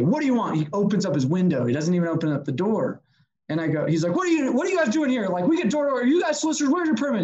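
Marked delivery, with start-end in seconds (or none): 0.1-0.6 higher-pitched, curt challenge; mock-gruff demand; hard guy. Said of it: "he was this guy that was, like, so hard". 4.1-7.2 high-pitched, rapid-fire mock yell; agitated, only half-raised voice; imitated yelling. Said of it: "He just starts yelling at me".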